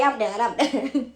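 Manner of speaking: ordinary talk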